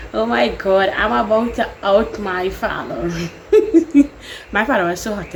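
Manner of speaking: normal talking voice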